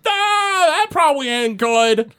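very high-pitched creature yelp; ghastly ogre with imp. Acted. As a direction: squeal it out in a put-on creature voice. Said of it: "ghastly ogre with an imp".

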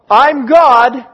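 a sudden loud proclamation; loud God reply. Acted. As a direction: declare it flatly as God. God answers the plea.

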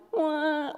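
mock two-year-old crying, high and soft; hammed-up baby "wah"; toddler wail. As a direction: wail like a little kid. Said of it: "kinda two-year-old crying".

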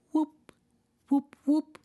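a playful high whoop like a car jumping on the map; high whooping sound. Retold or imitated. Imitating the car jumping on the map, high whoops.

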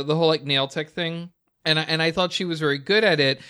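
normal talking voice

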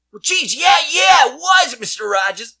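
hammed-up shouting baker. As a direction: blurt it out big and loud.

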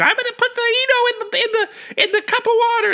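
very high, fast, loud mock mom; mother voice. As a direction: squeak it like a fussing mother. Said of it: "my mother would be standing there".

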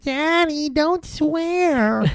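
put-on very high-pitched little girl voice. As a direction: squeal it like a small child, imitating. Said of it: "his little girl's like".

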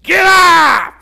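put-on shouted high, raspy old trainer; loud, raspy impression of an old man. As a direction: yell it like an old boxing coach. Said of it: "In that raspy old voice".

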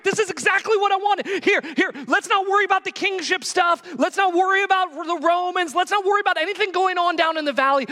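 high, giddy, rushing Peter begging to stay; excited Peter. Retold or imitated. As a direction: gush like an overexcited friend who won't leave. Peter voiced, excited on mountain.

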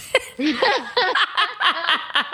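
a burst of high laughter. Real, not acted. Laughing at the idea of throwing water on someone.

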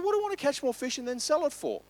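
genuinely can't see the point; lightly voiced puzzled fisherman.

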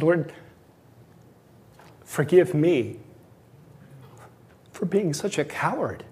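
quiet, choked-up prayer; praying with a lump in the throat. Real, not acted. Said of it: "Sorry, I'm choked up".

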